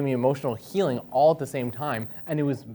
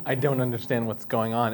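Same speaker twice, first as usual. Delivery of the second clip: usual speaking voice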